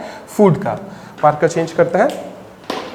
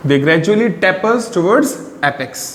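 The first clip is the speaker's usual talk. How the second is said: slow, deliberate note-taking pace; slow dictation; much slower than usual. Shown as a demonstration. Teacher dictating a line for students to copy, slowly. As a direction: read it out so they can write it down.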